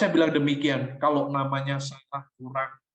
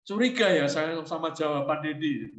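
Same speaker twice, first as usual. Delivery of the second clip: normal talking voice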